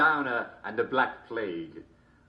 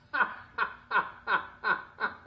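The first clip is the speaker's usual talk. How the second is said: high giggle; 10 semitones higher than usual. Real, not acted. Host laughs at punchline.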